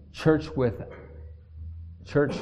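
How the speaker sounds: ordinary talk, nothing marked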